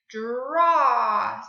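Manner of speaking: "Draw" loud with long open aw; vowel switch demo. Shown as a demonstration. Teacher reveals the changed word, loud drawn-out "Draw". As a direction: stretch the end of "Draw" loudly. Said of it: "change it to an ah".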